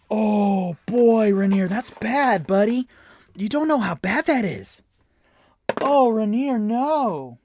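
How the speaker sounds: high, loud wail of dismay; alarmed exclamation. Real, not acted. Game master warning Rainier off the Mind Flayer, loud dismay. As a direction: cry out as if warning a doomed friend.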